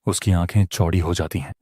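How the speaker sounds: usual speaking voice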